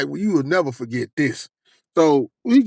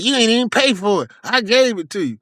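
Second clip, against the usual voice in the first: higher-pitched mock comeback; imitating her arguing back; retold indignant retort; 7 semitones higher than usual. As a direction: throw it back, high and indignant. Quoting her retort in argument.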